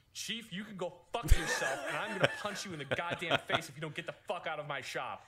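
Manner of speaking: loud, laughing furious threat; angry outburst. Retold or imitated. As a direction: yell at the chief. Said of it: "devolving into".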